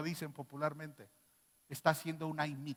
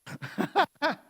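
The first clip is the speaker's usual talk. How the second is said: loud laugh; 10 semitones higher, 7 dB louder than usual. Real, not acted. Preacher laughing at own joke.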